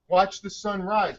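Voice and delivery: ordinary delivery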